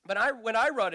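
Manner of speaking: ordinary talk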